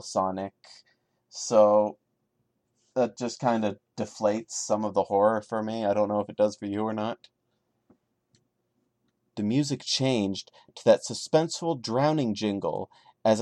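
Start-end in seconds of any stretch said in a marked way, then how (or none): none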